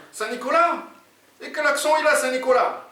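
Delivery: very high incredulous questioning; baffled sidekick. Acted. Oumar asks his partner about the accent, baffled.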